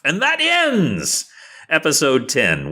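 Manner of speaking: high, drawn-out announcement of the ending; announcer's sign-off. Real, not acted. Game master closing episode ten, high drawn-out announcement. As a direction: stretch it out like a triumphant show closer.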